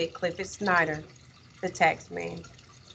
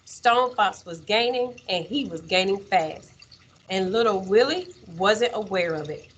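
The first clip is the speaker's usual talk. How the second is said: ordinary delivery, nothing marked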